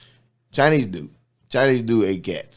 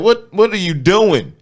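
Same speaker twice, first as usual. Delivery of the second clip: incredulous; 12 semitones higher, 8 dB louder than usual. Retold or imitated. His own retold disbelief.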